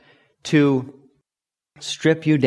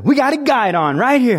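high, loud announcement to the whole platoon; mock instructor's shout; 11 semitones higher, 5 dB louder than usual. Drill instructor shows off the recruit to everyone, imitated shout. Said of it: "they called me a guidon".

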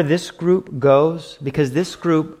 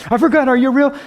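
higher, loud mock panic of forgetting; mock forgetful question; 11 semitones higher, 6 dB louder, faster than usual. A believer asking God in mock panic, higher and louder. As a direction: act suddenly forgetting whether he is real. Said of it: "you're walking going".

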